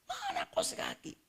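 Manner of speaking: very high, squeaky mock demand with a laugh; mock husband complaint. Husband demanding his socks, hammed-up and very high.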